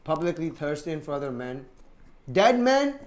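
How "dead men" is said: incredulous. Real, not acted. Shocked aside about wife.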